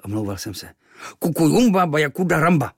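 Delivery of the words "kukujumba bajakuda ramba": louder, higher scowling gibberish; frowning, grumbling. Acted. Kvíkvék frowns at the boy, loud and higher. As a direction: sulk at your friend in your own language. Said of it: "mračil se Kvíkvék".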